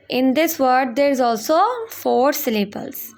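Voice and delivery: ordinary delivery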